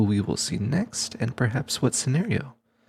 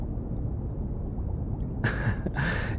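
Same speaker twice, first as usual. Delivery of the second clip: soft amused laugh; 7 dB quieter than usual. Acted. Researcher amused by the bodyguard's stubborn head, soft chuckle. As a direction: chuckle quietly to yourself.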